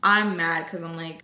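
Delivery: normal talking voice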